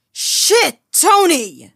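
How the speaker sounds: high, loud cry of alarm; sudden panicked yell; shocked shout. Acted. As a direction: yell his name in horror. Finding Tony with the knife.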